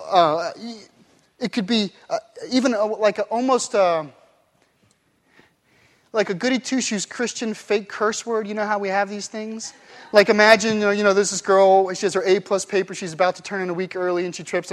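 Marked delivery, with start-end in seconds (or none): none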